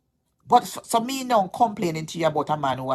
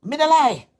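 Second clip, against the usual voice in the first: insistent; 8 semitones higher, 5 dB louder than usual. Real, not acted. Insisting on the pastor's words.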